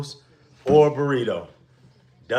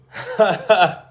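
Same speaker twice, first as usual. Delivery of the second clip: burst of laughter; 6 semitones higher, 8 dB louder than usual. Real, not acted. Laughs after starving remark.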